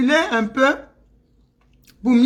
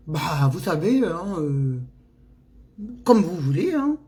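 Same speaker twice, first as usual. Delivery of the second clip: put-on mannered French accent; 5 semitones lower than usual. Shown as a demonstration. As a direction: switch to refined French accent, putting on manners. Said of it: "On prend des manières".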